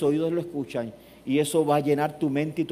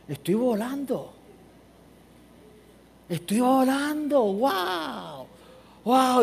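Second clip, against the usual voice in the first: awestruck wonder; 7 semitones higher, much slower than usual. Retold or imitated. Will Smith's wonder in freefall.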